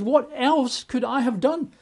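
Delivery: high, pleading divine lament; voicing God. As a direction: plead as a hurt God who did everything. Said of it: "God says".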